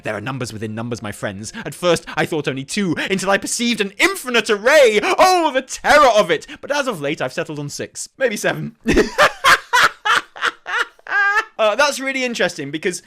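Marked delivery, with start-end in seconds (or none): 0.0-8.8 hammed-up mad scholar's reading; dramatic rambling scholar. Reads lore in character. 8.8-11.5 high, loud laughter; real high cackling laugh; big laughing fit. Real, not acted. Laughing at maybe seven.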